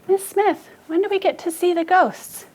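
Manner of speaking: high-pitched mimicked pupil question; imitating eager pupils. Teacher voicing pupils asking about the ghosts, high mimicry. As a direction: put on a high schoolkid voice. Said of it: "some of you have been asking me".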